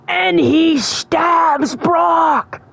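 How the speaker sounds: drawn-out high, dramatic shout; outraged story climax. Retold or imitated. Fan retelling a betrayal to viewers, aghast shout. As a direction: cry it out slowly, high and aghast.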